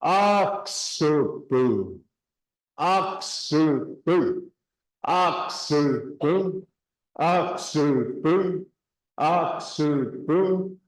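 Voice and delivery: oxupu drawn out slowly; slow drill. Shown as a demonstration. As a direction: repeat oxupu slowly, syllable by syllable. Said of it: "You put those two together".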